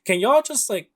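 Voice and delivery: high, loud comic protest, half laughing; bad guy to two heroes chatting mid-fight, indignant mock complaint; ignored bad guy. Bad guy begging to be noticed by chatting heroes, high mock whine. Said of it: "one of the bad guys is just like".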